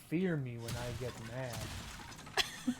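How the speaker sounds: low, slow, soft threat; mock menace. Gamer warning friends about their temper, quietly menacing. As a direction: warn them slowly in a hushed, low voice. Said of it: "the way you said the "fear me".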